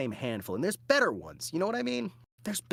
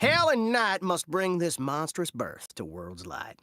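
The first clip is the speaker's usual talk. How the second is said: put-on terrible Southern accent; 5 semitones higher, 3 dB louder than usual. Villain hatches a monstrous plan, terrible Southern accent. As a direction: proclaim it in an awful Southern accent. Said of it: "doing a terrible Southern accent".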